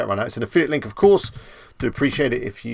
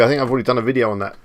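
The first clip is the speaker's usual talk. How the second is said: usual speaking voice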